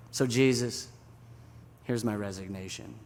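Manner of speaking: low, sinking, soft ending; defeated resignation. Acted. Peter quits to Jesus in the boat, defeated. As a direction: hand it in with heavy finality.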